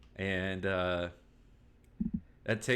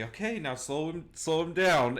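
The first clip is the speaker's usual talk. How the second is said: calling out; 7 semitones higher than usual. Acted. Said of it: "you call out to her and say".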